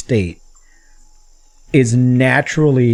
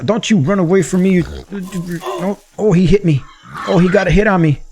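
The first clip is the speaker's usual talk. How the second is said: high-pitched cries mid-fight; excited combat chatter; 8 semitones higher than usual. Real, not acted. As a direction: call out high in the heat of the hunt. Fighting the boar in game.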